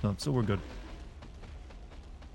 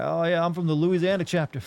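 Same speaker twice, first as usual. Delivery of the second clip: put-on Southern accent; 4 semitones higher than usual. Streamer mocking summoned co-op partners in a Southern accent. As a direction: joke as a Confederate in a Southern accent. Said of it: "You got that accent down?".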